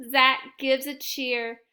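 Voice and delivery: ordinary delivery, nothing marked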